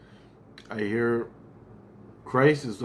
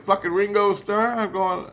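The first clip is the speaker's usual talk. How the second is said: higher, louder disbelief; incredulous; 8 semitones higher, 4 dB louder than usual. Retold or imitated. Reacting to hearing [name] is coming, high disbelief. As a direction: scoff like it's a bad joke. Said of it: "I'm like".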